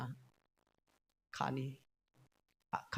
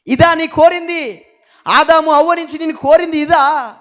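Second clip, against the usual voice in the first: God's grieved cry; 13 semitones higher, 16 dB louder than usual. Acted. Preacher voices God's lament.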